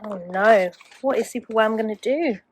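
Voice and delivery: plain reading voice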